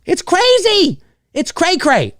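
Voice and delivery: high, sing-song silly exclamation; playful cray cray. Real, not acted. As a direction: toss it off in a goofy squeak. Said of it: "as they say".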